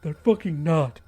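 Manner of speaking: a touch of tears as he snaps back; understated sobbing protest. Acted. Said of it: "he said, sobbing".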